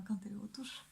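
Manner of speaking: giggly whispered echo; hushed, breath-only repeat, amused; playful whisper. Pretend. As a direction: whisper it with a suppressed laugh. Giggling, whispered repeat of her words.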